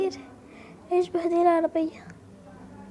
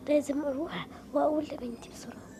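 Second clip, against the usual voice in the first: whisper; 4 semitones lower, 8 dB quieter than usual. Acted. Mother whispering to herself.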